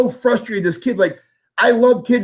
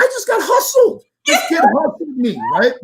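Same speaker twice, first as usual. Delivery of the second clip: laughing disbelief; 13 semitones higher than usual. Real, not acted. Laughing at himself for getting hustled by a kid. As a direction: crack up at being conned.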